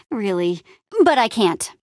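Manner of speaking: usual speaking voice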